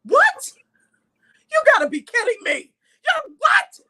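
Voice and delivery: high, loud squeal of fake astonishment; mock shocked disbelief. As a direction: play up the shock, high and bright.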